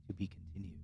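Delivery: whispered banner reveal; very soft, fully whispered reveal; hushed whisper. Acted. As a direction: whisper it like an ominous title card. Banner text read out hushed.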